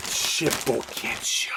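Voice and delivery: breathy secret whisper, slowly. Pretend. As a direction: hush it like a secret reveal. Teasing whispered product announcement.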